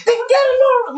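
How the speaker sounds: a mother's furious yell through the door; mom yelling. Retold or imitated. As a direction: burst in screaming at the kids.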